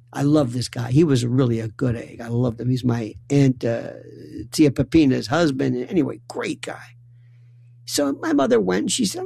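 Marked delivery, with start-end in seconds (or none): none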